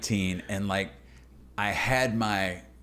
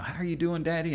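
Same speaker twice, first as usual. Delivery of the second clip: higher, sweet little daughter voice; daughter's voice; 9 semitones higher than usual. Retold or imitated. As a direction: voice the daughter checking in. Daughter voiced at mile fourteen.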